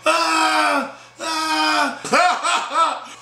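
high, drawn-out "ah", twice; mock scream. Storyteller mimics Brian's girl at the fight, mock scream. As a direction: imitate a screaming woman with long high wails. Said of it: "Sophia screaming".

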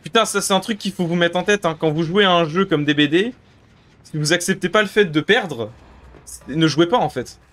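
ordinary talk, nothing marked

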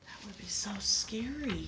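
soft whispered aside. Real, not acted. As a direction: whisper it to the listeners.

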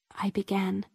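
ordinary delivery

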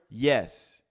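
firm, loud, definite yes; absolute yes. Acted. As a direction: answer with no doubt at all. Acting out absolute answers.

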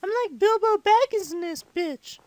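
put-on little kid gamer voice; five-year-old impersonation. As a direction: chat to viewers like a squeaky young child. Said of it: "I talk like a five-year-old".

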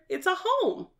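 ordinary talk

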